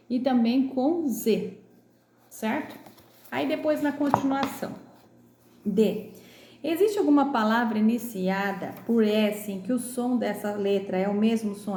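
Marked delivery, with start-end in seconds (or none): none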